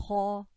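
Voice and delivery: kha scraped in the throat with a dark aw vowel; heavy kha. Shown as a demonstration. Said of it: "is a heavy letter".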